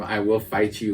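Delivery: ordinary talk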